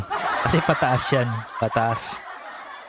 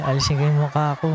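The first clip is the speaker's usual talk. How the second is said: ordinary delivery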